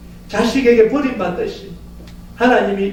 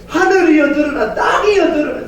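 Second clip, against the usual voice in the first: raised, exasperated divine call; God crying out; 4 semitones higher than usual. Acted. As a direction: cry out to heaven and earth in frustration. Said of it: "그럼 하나님 뭐라 그래요".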